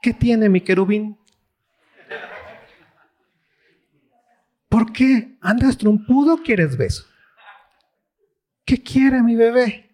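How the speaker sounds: put-on high baby-talk cooing to a sulking husband; wife babying her sulking king husband, high and sweet; doting Jezebel. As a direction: coo at him like a pouting toddler. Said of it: "viene Jezabel y dice".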